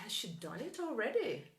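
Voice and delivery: has she done it already? with a falling surprised tune; surprised question. Shown as a demonstration. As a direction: ask it like you can't believe it. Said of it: "mais natural colocar no final assim". (pergunta surpresa, tom caindo)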